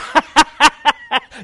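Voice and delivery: high, loud jeering cackle; mocking laugh. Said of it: "young man's right there saying".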